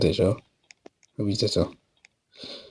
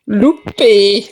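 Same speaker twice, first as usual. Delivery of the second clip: excited; 8 semitones higher, 5 dB louder, much slower than usual. Real, not acted. Monster misses him.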